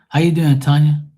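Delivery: calling out a greeting. Real, not acted. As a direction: hail someone who just joined. Calls out greeting to viewer Tanya.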